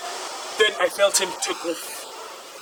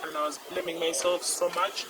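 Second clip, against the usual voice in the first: ordinary talk, nothing marked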